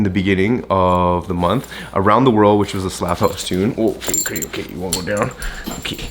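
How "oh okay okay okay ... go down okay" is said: soft talk to a pet. Real, not acted.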